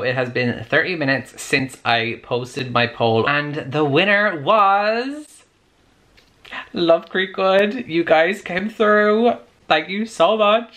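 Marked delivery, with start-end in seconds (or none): 3.3-5.3 high, drawn-out announcement; excited winner reveal. Real, not acted. 6.7-10.7 high, drawn-out, excited thanks; delighted cheer. Real, not acted. Celebrating the winning book, thanking viewers.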